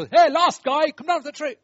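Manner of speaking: loud, high jeering call; loud mocking hey, lost guy; mock shout. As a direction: yell it at him rudely. Mock harsh calling.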